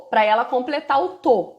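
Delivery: usual speaking voice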